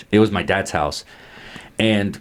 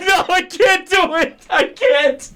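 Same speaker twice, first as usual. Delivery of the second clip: breaking up laughing; slower than usual. Real, not acted. Laughing, can't go on.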